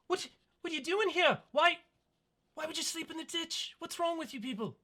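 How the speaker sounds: put-on high, fast incredulous kid; baffled child. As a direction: pepper them with questions like a kid. GM voices elven children.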